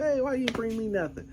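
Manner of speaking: high-pitched wife impersonation; imitated wife. Husband mimicking his wife asking for her share, high-pitched. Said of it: "My wife said".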